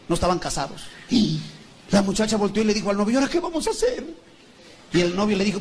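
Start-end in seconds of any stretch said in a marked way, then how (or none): 3.2-4.0 high-pitched, pitch climbing through the question; worried girlfriend. Acted. Pregnant girlfriend to boyfriend, high worried question. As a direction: play the girlfriend in a predicament.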